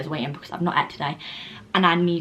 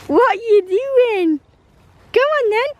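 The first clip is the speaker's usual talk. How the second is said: much higher, louder coaxing to the dog; squeaky pet-talk to the dog; high dog-calling voice; 4 dB louder than usual. Real, not acted. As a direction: call the dog in a high sing-song.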